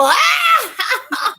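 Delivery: laughing shout. Real, not acted. Laughing burst mid-story.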